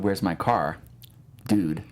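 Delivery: ordinary talk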